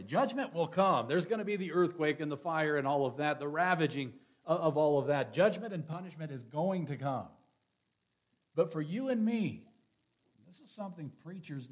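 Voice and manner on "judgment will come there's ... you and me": louder, higher warning of judgment; stern pulpit warning; forceful preaching. Acted. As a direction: thunder it from the pulpit. Warns of coming judgment.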